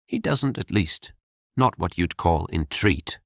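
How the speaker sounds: lightly voiced young woman, dry and wry; a hint of a bitter maid, understated. The girl tells Jorian how her master treats her. Girl's voice in dialogue.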